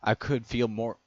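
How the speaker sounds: usual speaking voice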